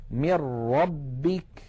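من ربك drawn out as "mir-rabbik", nun merged into a doubled ra; full assimilation into ra; slow recitation. Shown as a demonstration. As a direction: swallow the n into the r. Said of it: "مثال الراء".

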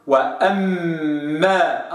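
وأما slowly, second m clear; audible m. Shown as a demonstration. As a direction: make the m in وأما heard. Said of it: "أطلع بميم مسموعة".

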